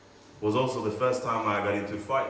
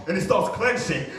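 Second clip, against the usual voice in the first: ordinary talk, nothing marked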